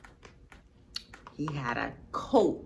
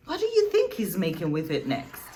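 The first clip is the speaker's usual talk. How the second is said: plain reading voice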